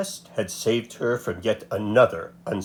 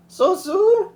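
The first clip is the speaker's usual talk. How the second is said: put-on much higher old grandmother voice; 3 dB louder than usual. A narrator's reading. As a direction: sound like a kindly old abuela.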